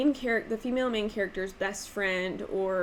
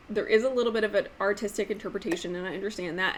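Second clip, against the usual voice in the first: ordinary talk, nothing marked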